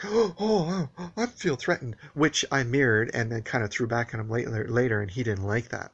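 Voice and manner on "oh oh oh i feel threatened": gasping, overacted fake fear in a higher, louder voice; mock drama. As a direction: mimic him hamming up being threatened. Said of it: "making a big drama out of it".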